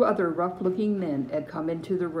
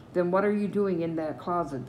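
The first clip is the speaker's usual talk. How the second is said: plain reading voice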